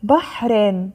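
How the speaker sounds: بحر read with an added -in ending; tanween kasr. Shown as a demonstration. Teacher reading out the word list, tanween kasr on بحر. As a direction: sound out بحر as "bahrin". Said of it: "كسر".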